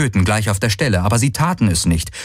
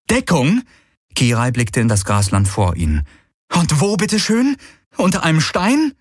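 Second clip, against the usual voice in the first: high, sceptical echo of Deckung; incredulous; 7 semitones higher than usual. Acted. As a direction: throw the word Deckung back at him. Kirai echoes incredulously. (Kirai fragt Galen ungläubig zurück, spöttisch)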